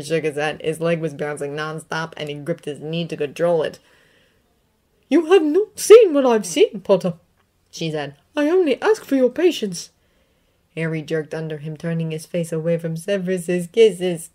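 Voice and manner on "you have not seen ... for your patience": put-on loud, high, tart old witch professor; McGonagall. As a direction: scoff at the fools in the Ministry. McGonagall asks for patience.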